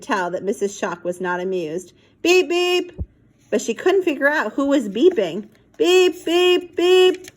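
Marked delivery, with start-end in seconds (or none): 2.2-2.9 high clipped beep beep; toy horn beeps. Acted. Monkey honking the toy horn in the garage, high beeps. 5.8-7.3 high clipped beep beep; toy horn beeps. Acted. Monkey honking the toy horn in the garage, high beeps.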